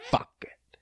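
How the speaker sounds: whispered under the breath; a whispered aside. Real, not acted. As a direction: drop to a whisper.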